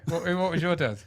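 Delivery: ordinary talk, nothing marked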